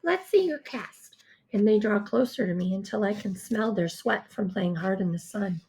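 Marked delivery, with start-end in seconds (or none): 0.0-1.2 put-on high, pushy children's chorus; demanding kids. A narrator's reading. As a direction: badger her loudly like eager kids. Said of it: "they demand".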